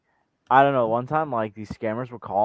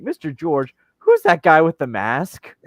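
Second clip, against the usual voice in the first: imitated curious pupil; 3 semitones higher, 7 dB louder, slower than usual. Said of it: "they'd be like".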